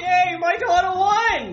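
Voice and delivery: high, slow drawn-out cheer; excited cheer. Acted. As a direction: cheer like a proud dad. Dad cheers daughter's win.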